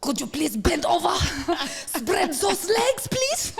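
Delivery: high, slow, hammed-up attempt at a German accent; a playful bad German come-on; a failed German accent, high and drawn out; mock German accent. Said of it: "That doesn't sound like a German accent".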